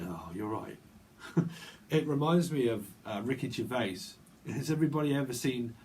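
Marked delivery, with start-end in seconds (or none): 0.0-0.8 low, soft imitation of a creep; mock sleazy greeting, dropped low. Imitating someone she keeps picking up, sleazy. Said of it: "say things very sleazy".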